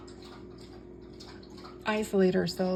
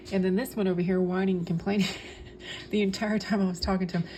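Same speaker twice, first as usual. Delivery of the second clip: ordinary talk, nothing marked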